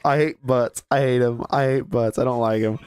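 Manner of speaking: very low, sullen grumble; deep grumpy voice. Acted. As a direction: growl it like a brooding kid. Voicing a character, deep voice.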